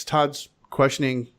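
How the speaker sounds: usual speaking voice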